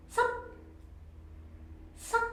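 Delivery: sup high and cut short; high checked tone. Shown as a demonstration. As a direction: clip sup sharply at the top. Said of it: "The seventh tone is sup".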